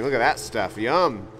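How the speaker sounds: ordinary delivery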